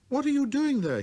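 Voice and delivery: put-on a curious boy calling out; little prince. Prince voiced.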